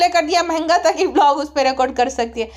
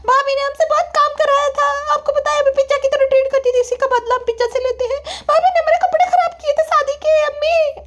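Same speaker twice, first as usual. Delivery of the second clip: very high, whiny complaint; daughter complaining; 12 semitones higher than usual. Acted. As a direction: complain to mother in a high childish whine. (शिकायत करती बेटी की बहुत ऊँची आवाज़)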